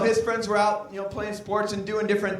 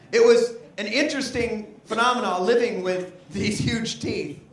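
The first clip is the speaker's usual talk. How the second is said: ordinary talk, nothing marked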